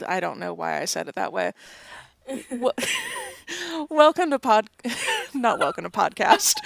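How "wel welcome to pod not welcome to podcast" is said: laughing through the words; loud, real laughter breaking up the line; cracking up. Real, not acted. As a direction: try to speak and break into giggles. Cracking up over botched intro.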